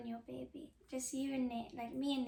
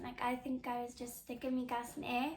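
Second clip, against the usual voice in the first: ordinary talk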